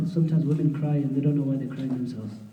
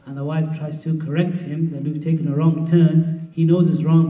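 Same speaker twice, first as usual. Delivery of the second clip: usual speaking voice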